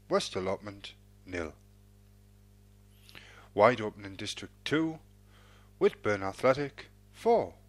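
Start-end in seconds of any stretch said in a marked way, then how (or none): none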